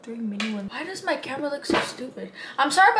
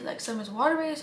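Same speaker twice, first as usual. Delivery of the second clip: ordinary talk, nothing marked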